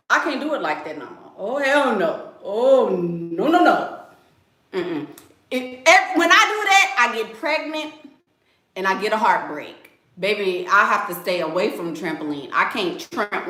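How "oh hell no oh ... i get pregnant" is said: usual speaking voice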